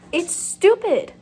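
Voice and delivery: "stupid" dragged out, loud and high; exasperated outburst. Real, not acted. Annoyed reader venting about a bad book, loud slow outburst. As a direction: blurt "It's stupid" slow and loud in frustration. Ranting about the book's plot.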